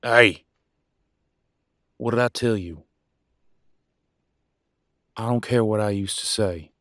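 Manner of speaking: loud, forceful rebuke; angry warning. Acted. Warning mate off using that term, angry and loud. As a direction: lay down the law, loud and firm. Acted quarrel, man warns listener off.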